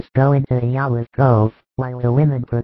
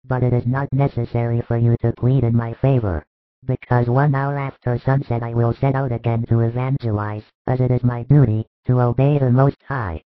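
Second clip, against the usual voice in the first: steady, firm, a little louder, never harsh; calm but resolute; 3 dB louder than usual. A narrator's reading. Jesus refusing Joseph's plea, calm and resolute. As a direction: settle it calmly and without doubt. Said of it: "calmly but very resolutely".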